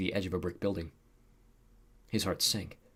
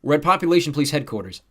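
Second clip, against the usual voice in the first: loud, raised-pitch cut-in; interrupting; 7 semitones higher, 9 dB louder than usual. A narrator's reading. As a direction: cut in to take over the report. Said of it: "Tucker interrupted".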